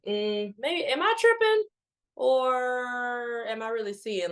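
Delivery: high-pitched questioning, then a long drawn-out "or"; exaggerated high wondering voice; mock self-doubt. Acting out her own doubting thought.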